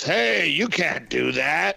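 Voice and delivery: put-on very slow old-man scolding; grumpy old guy. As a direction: wag a finger like an out-of-touch old man. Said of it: "the old guy that goes".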